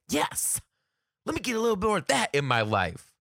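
high, excited approval; gushing admiration. Retold or imitated. Acts gushing over the secretary.

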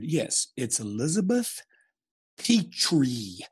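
slow, deliberate imitation of spelling out. Dad giving his wife's maiden name, spelling it out. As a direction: spell the name out for a caller, rising on the letters. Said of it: "He, like, spelled it out".

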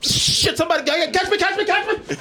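high, hammed-up cry of a falling cameraman; mock panic. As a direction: squeal it like you're toppling over, laughing at him. Mocking the stumbling cameraman.

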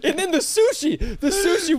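high, laughing through the words. Real, not acted. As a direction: giggle out the words. Laughing while retelling the scene.

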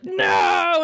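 sudden low shouted no; devil's shout. Retold or imitated. Retelling the devil's scream at the end, a low shout. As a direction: bellow it like the defeated devil. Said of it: "the devil's like".

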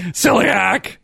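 mock dramatic celiac. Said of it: "say it like that".

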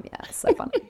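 breathy mock-amused whisper; sarcastic whisper. Pundit mocking a tired Brooklyn joke, sarcastic whisper. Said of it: "I'm just gonna laugh the seven thousandth time".

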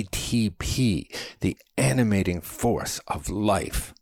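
high-pitched, building lecture; impassioned diatribe. A narrator's reading. Man expounds cellular respiration to companion, impassioned diatribe. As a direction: launch into it with mounting fervor. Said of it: "launch into a diatribe on cellular respiration".

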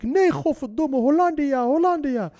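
high, loud mock protest; retold desperate shout. Captive insisting to soldiers he is Dutch, retold shouting. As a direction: cry it out as the accused captive. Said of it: "riep ik".

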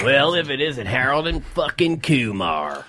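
slow, put-on gruff boss voice; Michael Hayes impression. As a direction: drag out the insult slowly.

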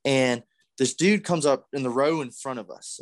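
ordinary delivery

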